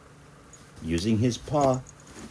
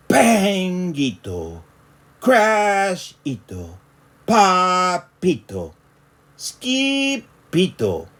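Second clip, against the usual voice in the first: slow, louder, higher crash calls; dramatic sound words; 8 semitones higher, 5 dB louder, much slower than usual. A narrator's reading. Narrator calling out the crashes in the room. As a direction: hit each crash word big.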